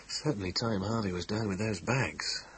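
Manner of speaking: thinking aloud. Acted. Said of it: "thought Temple".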